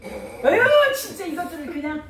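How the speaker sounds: loud, fed-up mother scolding; exasperated scolding. Real, not acted. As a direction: snap at them, fed up and loud. Mother scolds squabbling kids.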